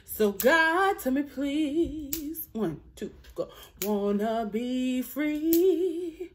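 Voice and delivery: ordinary talk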